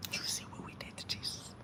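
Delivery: a fast breathy whisper with no voice; whispered threat. Acted. Church elder repeats the Jesus threat to the preacher in a whisper. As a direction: whisper it like a dark secret.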